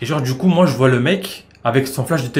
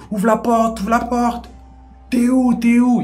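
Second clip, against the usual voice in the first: high, hollering at a door; yelling; 9 semitones higher, slower than usual. Retold or imitated. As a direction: holler to be let in. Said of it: "il hurlait".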